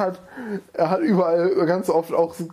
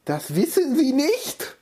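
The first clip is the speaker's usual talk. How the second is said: incredulous woman; 7 semitones higher, slower than usual. Acted. Woman asks in character.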